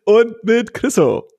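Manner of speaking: slow, high host introduction; drawn-out gala announcement; put-on intro voice. Real, not acted. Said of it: "das ist meine Intro-Stimme".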